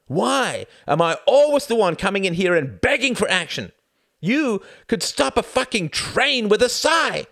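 loud, high furious outburst; furious shout. Acted. Son shouting at his mother in fury. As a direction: rail at her at full force.

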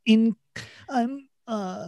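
high-pitched, hesitant imitation; mock vulnerable rapper. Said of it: "I'm vulnerable".

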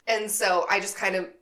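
normal talking voice

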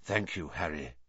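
soft, low gratitude; quiet thanks. Acted. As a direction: say it softly, tired. Lupin quiet.

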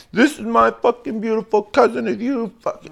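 high, loud mock rowdy yell; dramatic drunk relative. Rowdy relative introducing a cousin to her date, mock dramatic yelling. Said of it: "all dramatic and shit".

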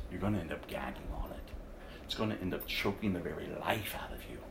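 low, quiet voice of coming judgment; ominous hush. Real, not acted. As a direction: drop the voice to a menacing hush. Preacher drops to ominous hush.

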